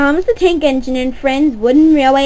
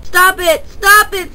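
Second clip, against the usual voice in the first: pleading shout; 6 semitones higher than usual. Acted. Thomas pushed down the hill.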